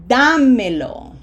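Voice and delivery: Dámelo slow and loud, stressed on Dá; slow teacher demo of Dámelo, falling after Dá; long vowels, first-syllable stress. Shown as a demonstration. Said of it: "Long vowels and emphasis on".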